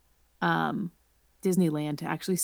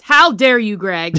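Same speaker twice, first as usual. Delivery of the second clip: loud, playful indignant how dare you; hammed-up offended shout at her co-host; mock outrage; 10 dB louder, slower than usual. Fake-offended at Greg's refurb question, loud mock outrage.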